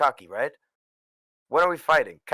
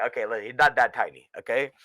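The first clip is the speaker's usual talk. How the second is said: usual speaking voice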